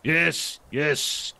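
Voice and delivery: usual speaking voice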